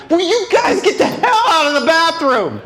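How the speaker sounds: high, loud fed-up shout; mock yell at the parents; exasperated yell. Son yelling at his parents to leave, loud and high.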